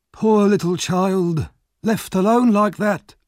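slow, high, loud lament; pitying Nancy. Acted. Nancy drunkenly lamenting the lost boy. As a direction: mourn the child.

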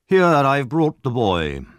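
slow, loud self-important beadle; pompous bellowing beadle. A narrator's reading. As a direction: announce the boy grandly like a beadle. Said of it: "said the beadle".

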